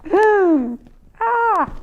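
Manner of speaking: high hmm, then a bright ah of discovery; thinking then aha. A narrator's reading. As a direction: sound like you just got the idea. Narrator pondering, then realizing.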